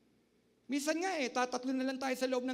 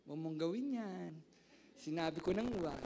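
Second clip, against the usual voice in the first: gentle calm version; 6 semitones lower than usual. Shown as a demonstration. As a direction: say it gently, without raising the voice. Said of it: "Pag nagagalit ka ba, banayad ka?". (mahinahong pagsasabi)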